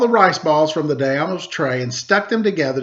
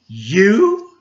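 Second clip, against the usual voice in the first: scoffing; 5 semitones higher, 5 dB louder than usual. A narrator's reading. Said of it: "the Daimyo laughed".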